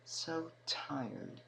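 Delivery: plain reading voice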